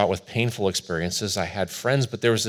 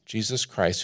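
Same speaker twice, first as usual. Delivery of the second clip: normal talking voice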